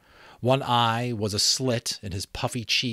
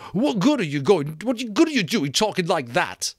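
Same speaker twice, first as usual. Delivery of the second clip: high, loud cry of Piggy; shrill protest; 11 semitones higher, 7 dB louder than usual. Acted. Piggy shrilly cutting Ralph off. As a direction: snap it out high and frantic. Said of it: "said Piggy shrilly".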